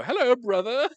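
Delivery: hammed-up formal greeting; overly proper greeting. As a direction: greet like a stiff proper Brit. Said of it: "I would go".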